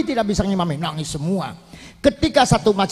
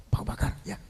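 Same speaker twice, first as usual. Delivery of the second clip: whisper; 11 dB quieter, much faster than usual. Retold or imitated. Bilal passes on message.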